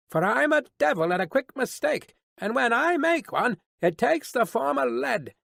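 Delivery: high-pitched guard's threat; calling a warning. A narrator's reading. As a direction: warn the rider off trickery, voice pitched high. Guard warns approaching rider.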